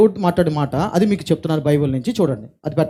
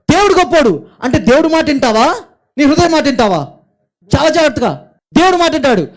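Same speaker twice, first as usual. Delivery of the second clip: higher and louder, pressing the congregation with questions; forceful preaching; 9 semitones higher, 7 dB louder than usual. Acted. Pastor urging listeners to trust God over their heart, fervent preaching. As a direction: hammer the point home from the pulpit.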